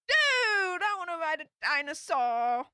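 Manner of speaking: a gleeful yelp of envy; excited dude. Real, not acted. Streamer reacting to a viewer's dinosaur story with excited envy.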